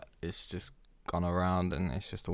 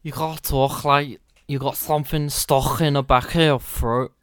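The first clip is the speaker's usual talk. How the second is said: loud, higher, throaty Scouse; Scouse accent; 5 semitones higher, 10 dB louder than usual. Shown as a demonstration. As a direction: put on Scouse with something stuck in the throat. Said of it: "How does a scoose talk?".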